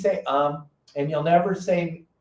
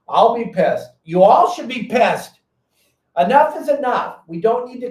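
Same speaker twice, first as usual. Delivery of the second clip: rising outrage; 6 semitones higher, 5 dB louder than usual. Real, not acted. Rallying fishermen against closure.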